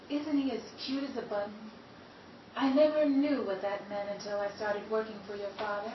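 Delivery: ordinary delivery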